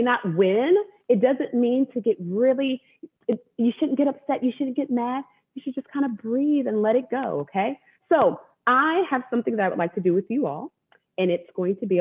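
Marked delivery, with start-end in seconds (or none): none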